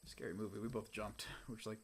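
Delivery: ordinary delivery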